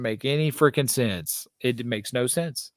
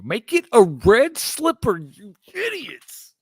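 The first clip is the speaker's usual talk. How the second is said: drawn-out, pitched-up rant at Nike; exasperated outburst; 8 semitones higher, 3 dB louder, slower than usual. Real, not acted. To cohost, ranting at sneaker designers, exasperated and slow. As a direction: stretch it out, mock-scolding the designers. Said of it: "I didn't know you were so animated".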